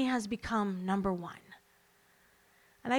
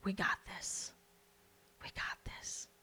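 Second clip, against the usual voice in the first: much softer whispered we got this; hushed reassurance; 5 semitones lower, 12 dB quieter than usual. Retold or imitated. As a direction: drop to a soft whisper, steadying. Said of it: "that firm hand going".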